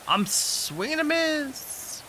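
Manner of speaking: high, drawn-out little voice; voicing game character. Streamer playing a miner in the game, high put-on voice. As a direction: stretch it out way up high, putting on her voice. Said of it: "Look at her. She's like".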